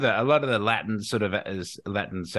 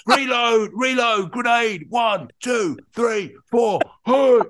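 high, loud combat callouts; shouted game script, call after call; video game soldier shouts; 12 semitones higher, 6 dB louder, slower than usual. Shown as a demonstration. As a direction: bark each call across a battlefield. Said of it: "they give you a, a very long script".

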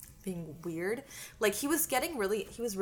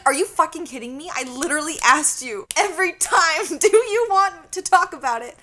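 angry retort; 6 semitones higher, 11 dB louder than usual. Retold or imitated. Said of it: "I was getting so mad".

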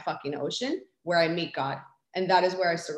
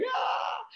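freaked-out scream; 5 dB quieter than usual. Retold or imitated. Retelling last year's freak-out, mock high scream. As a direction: let out a panicked little shriek in imitation. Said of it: "I freaked the fuck out".

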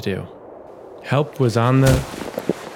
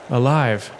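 usual speaking voice

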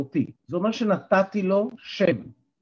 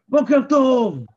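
high, loud bright בוקר טוב greeting; cheerful good morning; 9 semitones higher, 7 dB louder, slower than usual. Shown as a demonstration. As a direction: greet them with energy. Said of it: "אם אני אבוא ואני אגיד לכם". (בוקר טוב עליז, גבוה וחזק)

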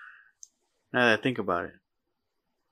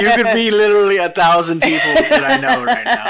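laughing; 5 semitones higher, 6 dB louder than usual. Real, not acted. Teasing friend, laughing while talking.

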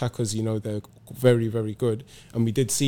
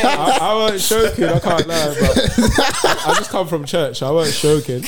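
loud, high laughing talk; 6 semitones higher, 6 dB louder than usual. Real, not acted. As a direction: laugh through the words. Laughing about joke.

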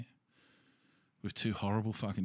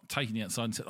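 ordinary delivery

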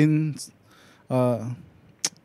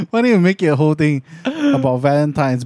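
ordinary delivery

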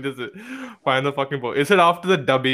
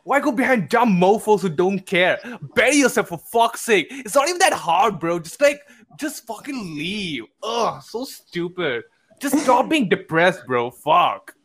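put-on American alpha-male bro, exasperated; American fuckboy accent; 2 semitones higher than usual. Friend reading a poster's relationship rant aloud, mock American fuckboy accent. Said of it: "like, a fuckboy, like, uh, you know, alpha male".

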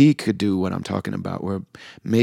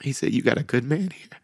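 higher, thin voice holding back tears; choked up; 4 semitones higher than usual. Real, not acted. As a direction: struggle to get the words out, with long pauses. Overcome recalling imam's words to wife.

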